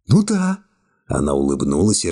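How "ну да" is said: put-on lightly raised young woman voice, cool and calm; young woman's voice. A narrator's reading. Secretary coolly refusing the hero's embrace, high young woman voice. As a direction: voice her higher, calmly distant.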